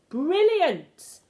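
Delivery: high, loud delighted cry; cried out. A narrator's reading. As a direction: burst out with delight. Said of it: "my grandmother cried out".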